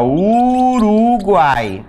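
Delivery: Uruguai drawn out very slowly; teacher modelling the word slowly; slow demo. Shown as a demonstration. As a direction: stretch each syllable of Uruguai. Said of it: "se eu tenho aqui, ó".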